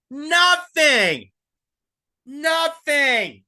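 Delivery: high, loud repeated Nothing; angry shout. Real, not acted. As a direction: yell it in outrage. Ranting at AOC.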